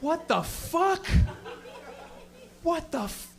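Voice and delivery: high-pitched, incredulous outrage; hammed-up horrified questioning; appalled disbelief. Acted appalled reaction to her news.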